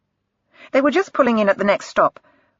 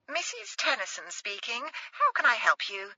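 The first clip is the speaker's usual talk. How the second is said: much softer, higher timid posh voice; timid posh mother; 4 semitones higher, 12 dB quieter than usual. Acted. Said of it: "in a timid but posh voice".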